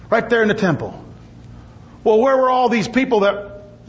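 ordinary delivery

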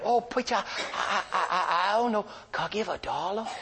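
halting, high imitated older man; simple older man's hesitant offer; Herbie. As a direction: stammer shyly in a put-on high voice. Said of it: "Oh, Herbie, how much would you like".

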